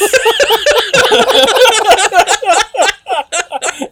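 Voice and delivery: long, high, loud laughter. Real, not acted. Cohosts crack up together, long loud laughter. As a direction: laugh until it runs out.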